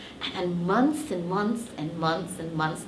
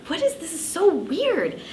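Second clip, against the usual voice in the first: baffled; 12 semitones higher than usual. Retold or imitated. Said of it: "really not happy".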